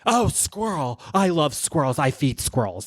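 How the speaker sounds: a mock distracted mom who drifts off mid-scold; a light take on a scattered mom scolding, then distracted; scatterbrained Denise. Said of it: "She's like".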